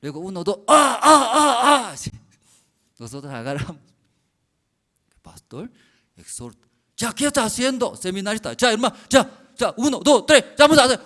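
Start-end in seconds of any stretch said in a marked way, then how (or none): 0.7-2.0 loud high grunts of strain; straining cry. Retold or imitated. Workers strain to lift the trailer, crying out. 7.0-11.0 high, loud rallying count; rally the crew and count them in. Retold or imitated. Pastor counts the workers in, loud and high.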